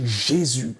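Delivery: ordinary delivery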